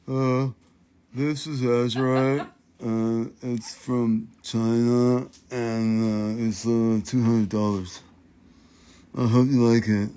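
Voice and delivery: low, halting, hesitant seller impression; dull live-show seller. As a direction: drone through a sales pitch, mimicking a boring host. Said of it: "where people are like".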